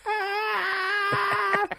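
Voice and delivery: long high frog shriek; drawn-out high imitation of a frog screaming; imitated frog scream.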